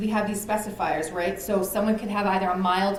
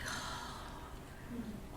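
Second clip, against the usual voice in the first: retold client's deflated, breathy oh, much softer; mock a worn-out sigh of oh; breathy groaned oh; 18 dB quieter than usual. Counselor imitating a withdrawn client, breathy groaned oh.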